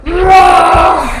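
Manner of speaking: loud growling roar; big playful bear roar for the kids; scary bear roar. Shown as a demonstration. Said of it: "I wanna hear you growl really loudly, like a really scary bear".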